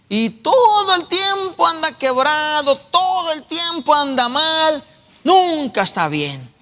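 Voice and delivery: high, slow, mocking lament; mocking exaggeration. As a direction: drag it out as if always broke. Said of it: "preacher mocks constant complaining". (lamento burlón, agudo y lento)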